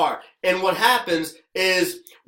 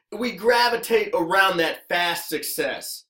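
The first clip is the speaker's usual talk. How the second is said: usual speaking voice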